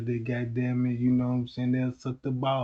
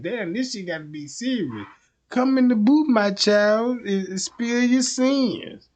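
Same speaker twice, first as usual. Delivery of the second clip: Pope; 9 semitones higher than usual. Acted. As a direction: play a mock Pope inviting a confession. Pope voiced in a skit.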